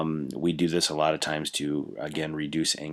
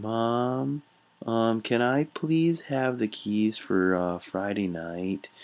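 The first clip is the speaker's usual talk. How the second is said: put-on higher, slow childish pleading voice; baby talk; 6 semitones higher, much slower than usual. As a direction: ask like a little kid begging mom. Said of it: "um, baby talk".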